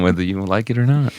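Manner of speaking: ordinary talk, nothing marked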